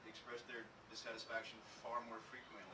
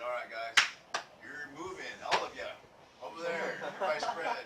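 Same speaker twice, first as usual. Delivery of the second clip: loud order to scatter, a bit higher; loud command; 4 semitones higher, 14 dB louder than usual. Retold or imitated. Manager ordering staff to split up, loud command. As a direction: boss telling staff to spread out, loud. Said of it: "I say it in, in a tactful manner".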